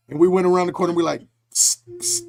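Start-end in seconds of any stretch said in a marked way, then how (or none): 1.5-2.2 sneaky attention-getting hiss, retold; mock furtive psst. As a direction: psst round a corner, playfully. Furtively calling wife round corner.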